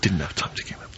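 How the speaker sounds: breathy whispered echo of excuse; mocking whisper. [name] mimics Karl's excuse.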